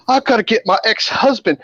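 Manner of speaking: high-pitched mock panic; imitated frantic patient. Patient says she must fetch her ex-husband, mock frantic.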